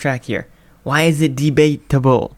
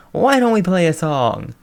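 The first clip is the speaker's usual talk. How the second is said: ordinary delivery, nothing marked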